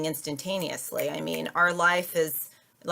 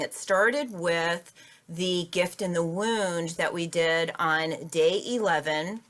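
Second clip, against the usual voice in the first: normal talking voice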